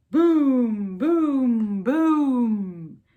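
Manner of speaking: loud booming thunder. Pretend. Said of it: "Mister Brown makes thunder".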